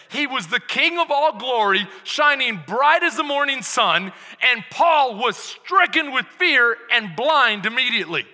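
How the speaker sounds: loud, rising sermon voice; preaching at full force. Acted. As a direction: preach it out with fire.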